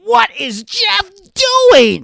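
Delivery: high, slow, loud mock commentator whine; hammed-up announcer gasp; mocking Michael Cole. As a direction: parody a baffled announcer, drawn out. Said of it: "He's like".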